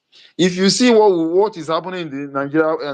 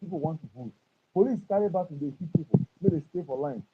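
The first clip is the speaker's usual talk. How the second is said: muffled and much softer; 4 semitones higher, 8 dB quieter than usual. Real, not acted. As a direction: speak as if through a hand over the mouth. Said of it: "your voice is getting muffled".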